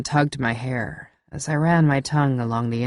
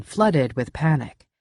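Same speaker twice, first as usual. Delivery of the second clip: plain reading voice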